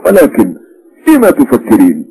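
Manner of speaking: lightly voiced husband, yawning and lazy; a drowsy, contented man's put-on voice; Ivan yawning. A narrator's reading. Husband yawns at his wife, lazy and full after dinner. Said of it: "وراح يتثاءب ويقول".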